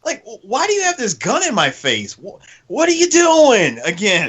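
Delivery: mock bother at a gun in the face; irritated. Ranger to the terrorist, mock irritated. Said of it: "Just irritated".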